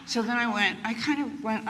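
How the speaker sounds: ordinary delivery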